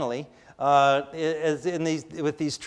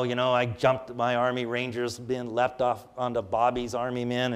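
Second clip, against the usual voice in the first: ordinary delivery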